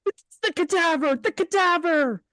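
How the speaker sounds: high urgent cry; high panicked shout; terrified. Acted. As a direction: blurt it in terror. Frank realizes the danger.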